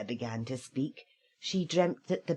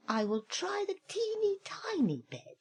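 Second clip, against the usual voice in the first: put-on little girl voice; 13 semitones higher than usual. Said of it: "she said".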